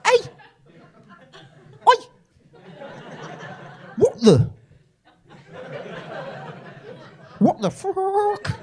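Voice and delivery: high, slow squawking outrage; angry chicken bloke. Retold or imitated. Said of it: "so angry he's turned into a chicken".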